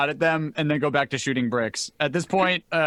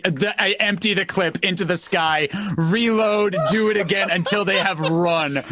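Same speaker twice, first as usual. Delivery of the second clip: ordinary talk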